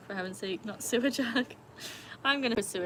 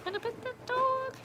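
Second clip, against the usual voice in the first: put-on much higher, slow childlike voice; little kid voice. Streamer repeating a child's question, very high and slow. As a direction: ask it like a wide-eyed small child. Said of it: "The same voice".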